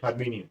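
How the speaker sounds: हर्मिनियन with the T dropped; silent T. Shown as a demonstration. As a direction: say हर्मिनियन with an N sound, no T. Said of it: "टी silent हो गया".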